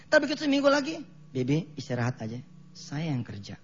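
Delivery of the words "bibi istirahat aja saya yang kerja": put-on softer and lower, a child gently offering to help; kind child. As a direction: tell her to rest, kindly. Child now helps the maid.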